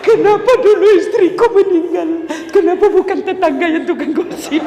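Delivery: hammed-up weeping cry, high and loud, half laughing; mock wailing husband. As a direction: wail like a grieving husband, played for laughs. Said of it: "lalu suamimu menangis". (tangisan suami yang dibuat-buat, tinggi dan keras)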